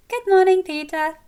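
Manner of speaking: very high, loud chirpy greeting; cheerful chirp. A narrator's reading. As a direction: chirp it out like an eager little sister. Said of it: "Prim chirps".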